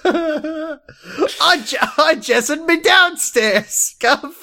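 recapping the show in a high, slow mock cockney; silly British voice. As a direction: ham up a chirpy cockney lad. Jokey British-ish character voice.

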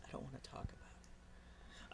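breathy sulky whisper. Pretend. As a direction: mumble it away like a moody teen. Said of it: "How much do you hate your dad?".

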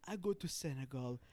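slowed mock African accent; hammed-up African accent. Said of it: "Mine is like".

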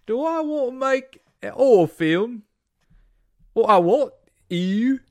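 high, loud Cockney chimney sweep; mock Cockney. Host voicing Nolan, high loud mock Cockney. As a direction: camp it up like a Mary Poppins sweep. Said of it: "like a Cockney, you know, chimney sweep".

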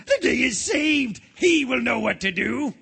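the day is saved, lift it up; relieved. Acted.

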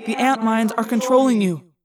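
urgent pleading. Acted. Urgent telepathic plea to ants.

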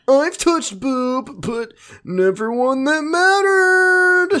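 very high, very slow creepy nerd; nasal smug neckbeard. Pretend. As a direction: drawl it out smugly like a creep. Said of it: "she goes".

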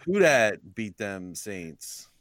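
slow drawn-out Cajun chant; Cajun accent. Pretend. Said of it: "doing the Cajun accent".

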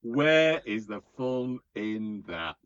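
loud, drawn-out mock protest; slow emphatic repeat. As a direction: stretch every word out in mock outrage. Repeats the question slowly for emphasis.

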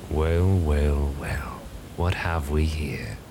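low, purring feline drawl; a sly cat toying with its prey; feline bargainer. Acted. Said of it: "more feline".

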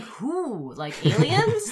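high incredulous rise on aliens; mock disbelief, pitch jumps up; sarcastic question. Sarcastic retort to Deep Throat.

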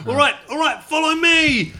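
high panicked guide. Acted. Player voicing panicked guide Vic.

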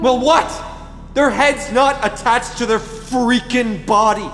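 loud, high, exasperated yell; panicked yelling. Acted. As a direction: yell in horrified disbelief. Panicked quarrel over body.

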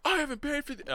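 high-pitched mock wail; imitated screaming. Kid lamenting his unpaid pills, high mock scream. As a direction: act out a screamed complaint, voice way up. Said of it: "Screaming up and down the yard".

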